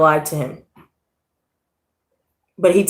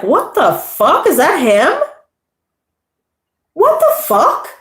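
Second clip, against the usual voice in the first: high, drawn-out stunned what the fuck; reliving the moment of spotting him; shocked disbelief; 8 semitones higher, slower than usual. Retold or imitated. Retelling spotting him in the store, stunned high disbelief.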